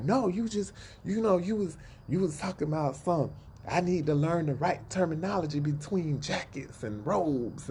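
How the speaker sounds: high whiny mock of Derek. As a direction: imitate him whining, high and petulant. Mocking Derek's defensive whine.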